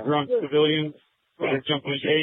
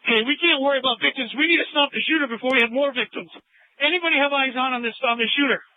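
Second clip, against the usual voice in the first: raised, high urgent command; 7 semitones higher than usual. Real, not acted. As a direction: push them hard, no time. Officer urging to stop shooter.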